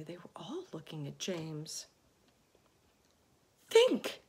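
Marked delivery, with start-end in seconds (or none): 3.7-4.2 very high, loud plea; begging. A narrator's reading. Miss Spider begging James. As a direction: beg desperately. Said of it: "begged Miss Spider".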